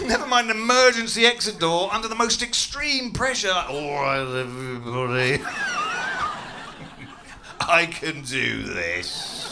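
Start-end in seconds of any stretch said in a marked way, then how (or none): 3.5-9.5 put-on drunk hero. Drunk passenger takes charge.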